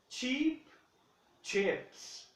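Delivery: "Cheap" high and long ee, then "chips" short; cheap versus chips. Shown as a demonstration. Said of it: "Be careful".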